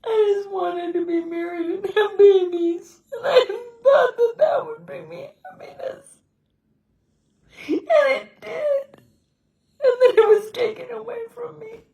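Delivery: high, breaking voice, sobbing through the words; real grief, crying as she speaks. Real, not acted. Widow telling viewers what was taken from her, sobbing. Remembering her marriage and lost husband.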